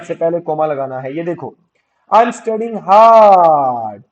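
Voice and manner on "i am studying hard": hard stretched very long and loud; slow dictation, holding the vowel of hard; drawn-out dictation. Shown as a demonstration. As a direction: belt out a long, sustained hard. Teacher dictates example, stretching it.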